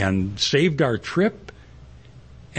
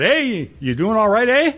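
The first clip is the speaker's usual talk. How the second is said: high, hearty Minnesotan greeting; Minnesota accent; 8 semitones higher, 4 dB louder than usual. Retold or imitated. Farm wife checking on a stranded driver, in a Minnesota accent. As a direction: imitate a friendly Minnesotan calling out. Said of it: "I can speak Minnesotan".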